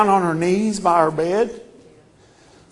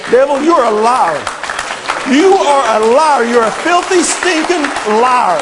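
louder and higher, shouting down the devil; rising angry rebuke; 4 semitones higher, 8 dB louder than usual. Acted. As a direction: build it into a furious yell at the liar.

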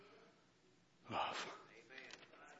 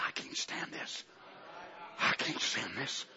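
hushed Holy Spirit voice; 7 semitones lower, 9 dB quieter, slower than usual. Acted.

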